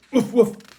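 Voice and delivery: high loud woof woof; loud yappy barking; dog barking. A narrator's reading. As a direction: bark happily. Dog barks.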